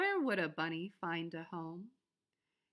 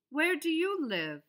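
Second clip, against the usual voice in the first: higher, louder question; bunny asking; 7 semitones higher, 7 dB louder than usual. A narrator's reading. Bunny asking the groundhog, higher and louder. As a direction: call out eagerly. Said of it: "he asked the groundhog".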